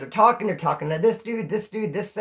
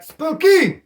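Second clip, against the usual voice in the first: spooky call; 9 semitones higher, 7 dB louder than usual. Retold or imitated. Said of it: "it's like".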